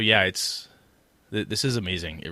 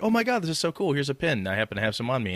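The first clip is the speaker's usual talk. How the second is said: retold excitement; 6 semitones higher, faster than usual. Podcaster retelling his own delight at meeting a listener, excited. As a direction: pitch jumps way up on the first line, then settles back down. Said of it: "I'm like".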